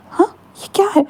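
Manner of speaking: high, stunned cry of disbelief; shocked. A narrator's reading. Groom seeing Wing in a dress, high shocked cry. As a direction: gasp it out in total shock. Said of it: "वांगजी just पूरा shock था".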